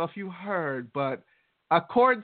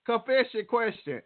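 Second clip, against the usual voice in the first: higher, sing-song segment title; segment announcement; 5 semitones higher than usual. Acted. Cohost introduces the confession question segment, sing-song. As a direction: announce it like a jingle.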